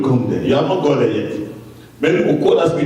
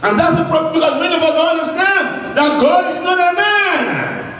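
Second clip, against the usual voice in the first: full-force preaching; 9 semitones higher than usual. Real, not acted. Preacher shouting to the church that God will not change his mind. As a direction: drive each line home to the congregation.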